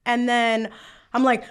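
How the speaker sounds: normal talking voice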